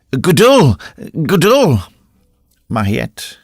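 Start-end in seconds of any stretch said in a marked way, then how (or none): 0.1-1.8 loud, high alarmed shouts; calling out. A narrator's reading. Gervaise calling the motionless recluse, loud alarmed shout. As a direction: shout the name into the cell. Calling to a possibly dead woman.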